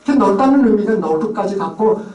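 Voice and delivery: ordinary talk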